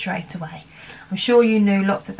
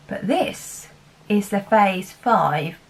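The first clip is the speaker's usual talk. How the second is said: ordinary talk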